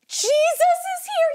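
high, loud thrilled announcement; excited crowd. Acted. Crowd seeing Jesus coming, acted excited cry. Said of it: "and they were like".

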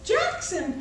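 ordinary delivery, nothing marked

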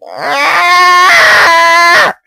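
high, loud yelled Ah; mock furious yell. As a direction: yell it like an angry friend.

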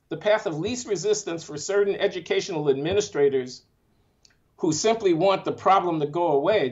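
ordinary talk

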